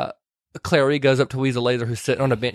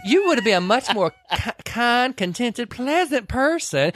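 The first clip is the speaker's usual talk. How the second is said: higher-pitched, halting film-character voice; retold advice; 8 semitones higher, slower than usual. Retelling Clary advising Weezer, high acted voice. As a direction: lay out each adjective, pitched up.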